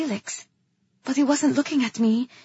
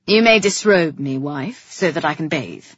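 loud start, dropping lower; commanding king; 6 semitones lower, 6 dB louder than usual. A narrator's reading. King ordering his wife to undress him, commanding. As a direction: grant it as a royal order.

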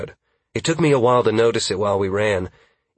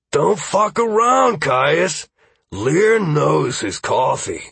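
slow, higher, louder mock warning; half-serious warning; 7 semitones higher, 4 dB louder, slower than usual. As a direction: warn him, only half joking. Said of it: "Trace warned, half serious".

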